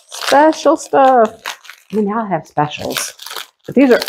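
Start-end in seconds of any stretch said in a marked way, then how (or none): 0.1-1.4 1 savoring relish. Pretend. Savouring the words.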